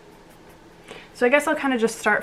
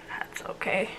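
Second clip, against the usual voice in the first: whispered aside, barely audible; 12 dB quieter than usual. Real, not acted. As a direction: breathe it out as an aside. Unvoiced aside.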